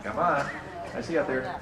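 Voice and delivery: ordinary talk, nothing marked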